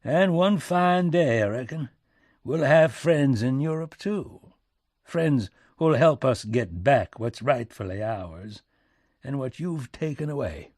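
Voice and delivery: folksy statesman issuing a threat; President Lincoln, defiant. Acted. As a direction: promise payback with relish.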